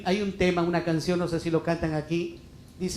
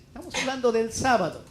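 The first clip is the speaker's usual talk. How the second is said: ordinary talk, nothing marked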